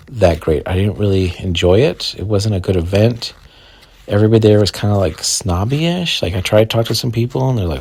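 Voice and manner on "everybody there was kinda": ordinary talk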